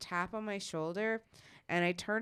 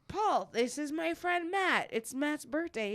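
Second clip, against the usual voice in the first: friend introducing; 8 semitones higher than usual. Retold or imitated. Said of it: "He's like".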